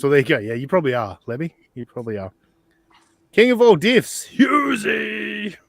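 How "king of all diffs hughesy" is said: big, drawn-out hype call, much higher and louder; crowning a friend as champion with a booming shout; mock announcer shout. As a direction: stretch the name out like a ring announcer. Hyping up Hughesy from chat.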